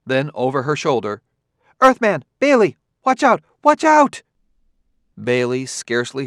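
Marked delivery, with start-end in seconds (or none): 1.8-4.2 high, loud urgent cry; urgent warning shout. A narrator's reading. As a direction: yell a warning over her shoulder. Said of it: "the note of urgency in her voice".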